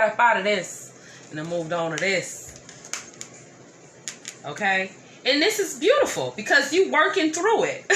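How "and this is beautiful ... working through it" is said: high and loud with delight; excited. Real, not acted. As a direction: burst out cheering them on. Excited reading of the card.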